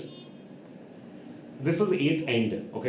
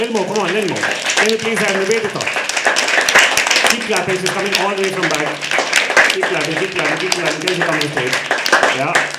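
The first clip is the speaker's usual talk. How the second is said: loud, high host call for applause; hyping the crowd; 4 semitones higher, 9 dB louder than usual. Real, not acted. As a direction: pump up the audience to clap. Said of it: "host hyping the crowd".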